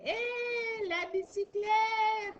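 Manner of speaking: long, high held "hé", carried into "la bicyclette"; high, slow sing-out of "hé, la bicyclette"; drawn-out sound demo. Shown as a demonstration. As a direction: stretch out "hé" and "la bicyclette" on a high pitch. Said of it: "ça fait quoi encore".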